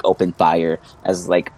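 ordinary talk

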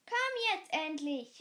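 loud impatient call; mother calling from downstairs. A narrator's reading. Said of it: "rief eine Frauenstimme".

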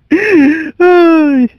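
high shuddering groan; cringing groan. Real, not acted. Host recalling scooter hits to the ankle, cringing groan. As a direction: let out a high, drawn-out cringe. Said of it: "I'm, like, shuddering, just cringing".

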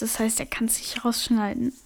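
soft conspiratorial whisper. Real, not acted. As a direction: whisper it so he can't hear. Secret remark while he's away.

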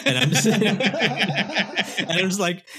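high, loud laughing words; words break into laughter. Real, not acted. Cracking up over the headline, loud high laughing.

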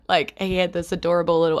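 ordinary talk

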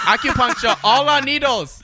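high-pitched fake Chinese accent; hammed-up Chinese accent; mock Chinese accent. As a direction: join in as a caricatured Chinese acupuncturist. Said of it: "everybody can do a Chinese accent".

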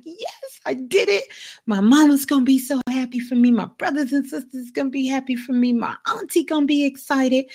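high, fast and thrilled; bursting with joy over his first food; super excited. Acted. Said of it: "he's so super excited".

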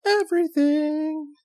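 very high, sustained comic wail; sudden leap up high, holding the word; high drawn-out cry. Real, not acted. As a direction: stretch it out on one high, held note. Drawn-out comic wail over a complaint.

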